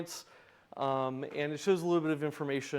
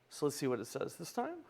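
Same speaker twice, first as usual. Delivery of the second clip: ordinary delivery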